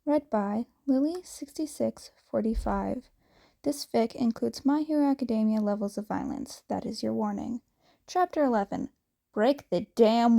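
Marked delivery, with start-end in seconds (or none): none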